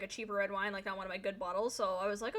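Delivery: ordinary delivery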